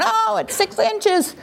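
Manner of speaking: high, drawn-out fan cry; slow, high squeal of suspense; crowd excitement. Acted. Football crowd agonizing over six inches, high excited cry. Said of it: "hundreds of thousands of people are going".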